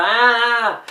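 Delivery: mock a toddler's tantrum cry; half-playful tantrum wail; imitated child's cry. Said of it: "to cry and go".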